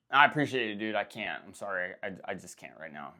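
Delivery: apologetic, fading to almost nothing; fading apologetic refusal. Retold or imitated. Declining the friend's offer.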